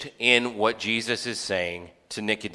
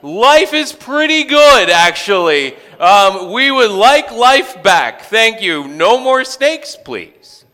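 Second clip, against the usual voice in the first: high, loud, slowed mock plea; hammed-up pleading of sorry Israelites; pleading repentant people; 13 semitones higher, 11 dB louder, slower than usual. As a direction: beg sheepishly for mercy. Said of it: "the people then go".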